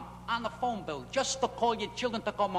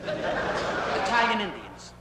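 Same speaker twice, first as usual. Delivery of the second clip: slow punchline; much slower than usual. Acted. Punchline drawn out.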